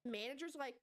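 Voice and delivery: ordinary delivery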